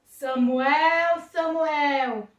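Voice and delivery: loud, drawn-out calling of a name; booming divine summons; voice of God. Acted. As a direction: call out grandly, sustained. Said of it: "o Senhor nosso Deus falou".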